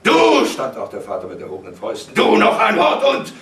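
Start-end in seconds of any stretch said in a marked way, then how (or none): 0.0-0.5 very high, loud single word; explosive warning; enraged shout. A narrator's reading. Father threatens with fists. 2.2-3.3 high, loud, furious warning; raging, cut-short threat; shouted threat. A narrator's reading. Father threatens with raised fists.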